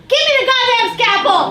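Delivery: high, loud snapping yell; mock-furious surgeon roaring for the scalpel; furious shout. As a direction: bark it at the nurse. Surgeon snaps at nurse.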